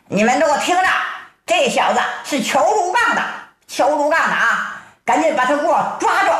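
high-pitched, urgent storyteller's shout; calling out orders. A narrator's reading. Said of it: "葛坤高声喊道".

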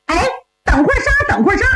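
high, rapid call to halt; urgent cry, pitched well up, quick. A narrator's reading. Woman calls to stop an execution, calling out. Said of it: "有人喊".

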